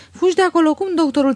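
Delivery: high, loud shrill outburst; shrieking. A narrator's reading. Aglaie shrieks at Marina about the doctor, loud and high. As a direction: shriek it in outrage. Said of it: "țipa Aglaie".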